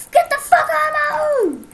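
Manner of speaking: loud, high shouted order; enraged yell at an intruder; furious scream. Real, not acted. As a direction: scream them out of the room.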